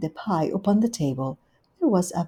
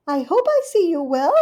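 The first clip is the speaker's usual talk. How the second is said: much higher, louder gushing hostess; Rivy's voice; 10 semitones higher, 6 dB louder than usual. Acted. Rivy welcoming Duchess, high gushing hostess. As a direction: greet her sweetly and grandly.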